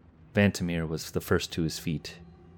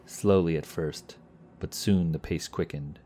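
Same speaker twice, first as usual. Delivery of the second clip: ordinary delivery, nothing marked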